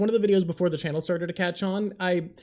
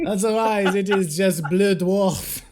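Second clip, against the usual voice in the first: louder hammed-up French accent; 2 semitones higher, 6 dB louder, slower than usual. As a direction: play a snooty French narrator. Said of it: "Your French accent should be used".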